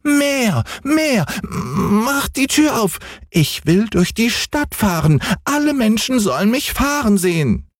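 hohe, quengelnde Kinderstimme; kleiner Junge schreit. Acted. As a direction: fordernd wie ein ungeduldiges Kind. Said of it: "schrie Häwelmann". (hoch und fordernd gerufen)

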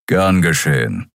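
plain reading voice

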